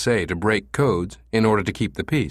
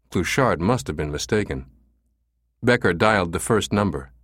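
ordinary delivery, nothing marked

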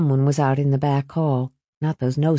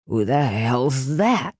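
put-on drunk father; 5 semitones lower, slower than usual. Drunk father sees stranger.

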